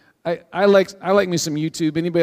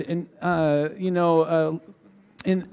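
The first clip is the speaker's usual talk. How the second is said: ordinary talk, nothing marked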